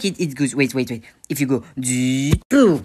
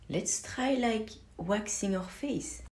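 ordinary talk

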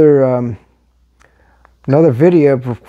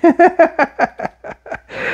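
a sudden, much higher laugh; high burst of laughter; 14 semitones higher than usual. Real, not acted. Reading about the Bulldogs snub, a high laugh. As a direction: crack up at the news.

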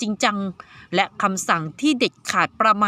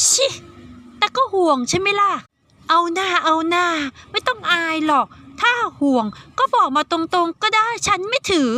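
put-on higher girl's voice, playful teasing; 7 semitones higher than usual. Saiyaka teasing Sanji that he worried, in a higher playful girl's voice. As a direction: needle him like a cheeky girl. Girl teasing her friend.

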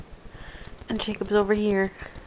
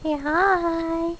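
talk to pet; 6 semitones higher than usual. Real, not acted. Coaxing cat.

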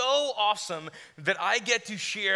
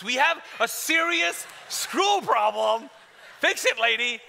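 insistent complaint; 4 semitones higher, slower than usual. Retold or imitated. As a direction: hammer it home to a clerk. Acted insistent complaint to clerk.

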